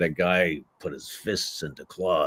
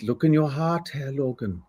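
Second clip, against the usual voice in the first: higher-pitched soulful German plea, softening at the end; soulful Nightcrawler; 7 semitones higher than usual. Acted. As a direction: appeal gently in a German accent. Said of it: "the soulfulness of the script".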